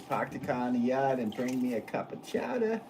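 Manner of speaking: badly faked Boston accent, playing it up. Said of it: "done that accent and stuff".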